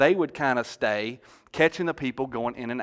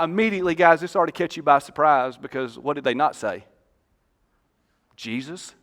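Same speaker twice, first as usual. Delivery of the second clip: normal talking voice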